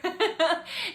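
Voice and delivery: loud, high burst of laughter; real laugh. Real, not acted. Laughs at idea of perfection.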